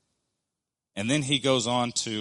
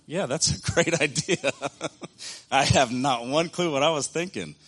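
laughing at himself; 6 semitones higher, 5 dB louder than usual. Real, not acted. Laughing at own bad idea.

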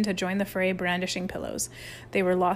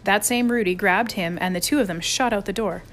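usual speaking voice

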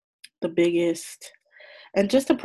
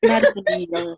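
excited; 7 dB louder than usual. Real, not acted. Protesting she's not country.

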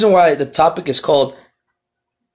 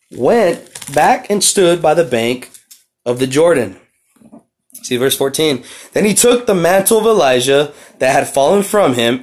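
scripture reading; 3 semitones higher, 3 dB louder than usual. Acted. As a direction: read the verses out like a preacher, slowing down. Reads scripture aloud.

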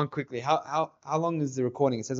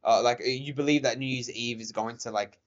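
ordinary delivery

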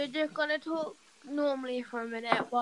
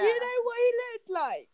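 excited shout; 10 semitones higher than usual. Real, not acted. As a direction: shout it out, thrilled.

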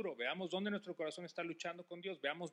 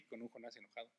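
ordinary talk, nothing marked

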